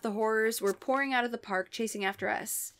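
ordinary delivery, nothing marked

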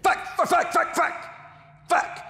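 imitated explosive high-pitched swearing tics; Tourette's outburst. Imitating a classmate with Tourette's, explosive high tics. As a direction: fire off sudden high, loud bursts. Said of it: "he had Tourette's. Jerry'd be like".

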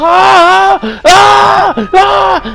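high, loud panicked screams; terrified screaming. Acted. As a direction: scream as if something grabbed you. Scared by monster in game.

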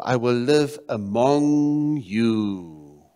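very slow, with long-held words; drawn-out proclamation. Acted. Preacher voicing God's desire to his people, drawn-out proclamation. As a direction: stretch out "among you" slowly. Dwells on God's promise, then repeats.

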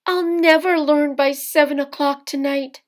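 high, loud cry of frustration; the mother voice, giving up on the peas; exasperated mother. A narrator's reading. Mother's voice in story.

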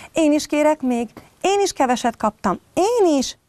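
high, eager little cub voices; begging cubs. Acted. Cubs beg for more kisses.

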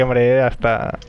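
normal talking voice

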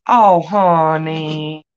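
slow, soothing "oh, honey"; tender pity; sympathetic. Real, not acted. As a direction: comfort a hurt friend. Comforts a viewer picked on.

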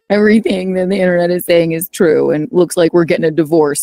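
ordinary delivery

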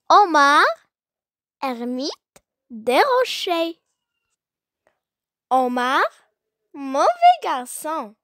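measured classroom poem reading, punching each name; poem recitation. Acted. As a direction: recite it line by line with strong melodic swings and long pauses.

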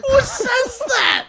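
high, slow squeal of disbelief; incredulous laughter. Real, not acted. Player reacting to friend's remark, helplessly incredulous. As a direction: wheeze it out high, scandalised and laughing.

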